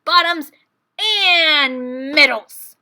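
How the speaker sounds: very loud furious bellow, dragged out at the end; a wide-awake bear laying down the law; hollering. A narrator's reading. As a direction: roar it across the field. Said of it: "he hollered".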